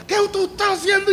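put-on high, indignant child's yell; angry little boy. Preacher voicing his son, angry at the swim teacher, high indignant squeal. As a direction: squeal it out like a furious small boy. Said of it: "mi hijo con una ira".

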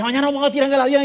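high, loud and fast, pumped with bravado; hyped-up boast. Retold or imitated. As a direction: brag it like it's nothing. Friends hyped about skydiving.

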